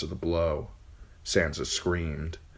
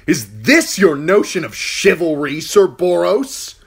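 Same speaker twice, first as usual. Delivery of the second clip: loud, higher, slowing angry challenge; angry demand; 12 semitones higher, 11 dB louder than usual. Acted. Uncle confronting the knight, loud and angry. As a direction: demand it with cold fury. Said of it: "demanded angrily".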